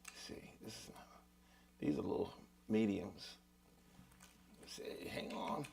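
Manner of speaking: half-whispered, murmured under the breath while rummaging; muttered to himself. Real, not acted. Teacher searching his pick case, muttering to himself quietly. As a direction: mumble it to yourself as you search for a pick.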